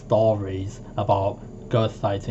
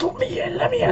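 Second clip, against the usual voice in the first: very high begging whine; childish pleading; much faster than usual. Retold or imitated. Child begging relatives to open the basement, squeaky whine. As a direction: plead like a nine-year-old. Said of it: "I was like".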